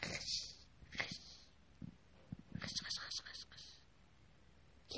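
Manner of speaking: very quiet, voiceless rustle; soft rustling noise. A narrator's reading. A sound Mehrabun hears at night, whispered rustling. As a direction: imitate a faint scratchy rustle in bursts. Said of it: "یه صدای آروم خشخش".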